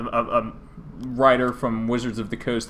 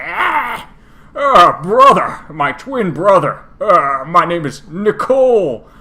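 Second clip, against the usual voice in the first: high, loud, slow melodrama; hammed-up villain brother voice; theatrical dragon twin; 7 semitones higher, 10 dB louder, slower than usual. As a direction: declaim it grandly, drawing out the words.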